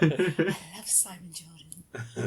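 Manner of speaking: slow, lightly voiced old man. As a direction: imitate a sensual old man, slowly. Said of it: "That's the old man voice".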